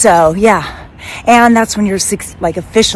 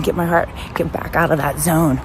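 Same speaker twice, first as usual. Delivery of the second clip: ordinary delivery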